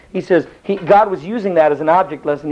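ordinary talk

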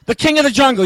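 ordinary delivery

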